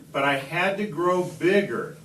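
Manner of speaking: plain reading voice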